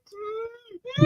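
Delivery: high, tiny sobbing hum; mock whimper. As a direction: squeeze out a pitiful whimpering hum. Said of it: "And he's like".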